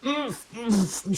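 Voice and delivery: smothered protest; muffled squawk. Acted. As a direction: squeak through a mouthful of coat. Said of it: "she squawked within".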